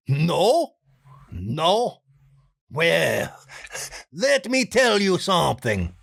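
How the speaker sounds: Juan voice. Pretend. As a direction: lean in to share a secret. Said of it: "Juan's next line".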